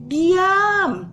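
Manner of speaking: calm the storm with authority; firm but gentle rebuke. Acted. Jesus rebukes the storm, firm then gentle. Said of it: "menghardik perahu itu dengan lembut".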